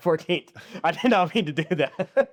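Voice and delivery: laughing through a slip of the tongue. Real, not acted. Host laughing at his own elevator floor mistake. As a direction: giggle it out, caught off guard.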